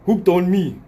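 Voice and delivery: mock African-accented catchphrase; lightly put-on African accent. Tanned customer ending the commercial, African accent. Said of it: "to an African and be like".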